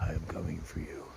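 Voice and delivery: quiet mock-villain voice to distant peaks; low menacing taunt. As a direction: growl a mock threat at the mountains.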